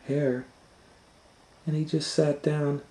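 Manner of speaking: ordinary delivery, nothing marked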